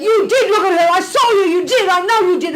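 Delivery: high, loud accusing cry, half laughing; mock jealous accusation. Girlfriend accusing boyfriend of looking at her, hammed-up jealous wail. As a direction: play the wronged girlfriend shrieking at him.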